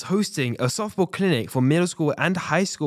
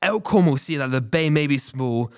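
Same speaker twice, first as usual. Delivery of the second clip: normal talking voice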